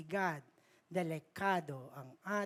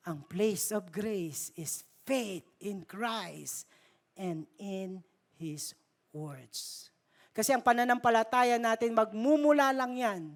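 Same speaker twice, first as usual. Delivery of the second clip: ordinary talk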